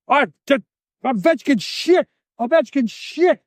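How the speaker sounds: high, indignant outburst in choppy bursts; mock angry captor. Captor rejecting the hockey player named, high mock anger. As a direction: imitate a captor furious at the wrong answer. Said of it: "he was mad".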